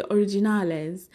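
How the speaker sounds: improvised mock Spanish accent. Shown as a demonstration. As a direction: make the English word sound Spanish. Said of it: "that's probably not how it's said".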